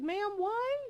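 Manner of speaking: high mock-incredulous plea; a pleading, disbelieving question. Retold exasperated question to neighbor.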